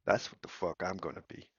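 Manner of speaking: normal talking voice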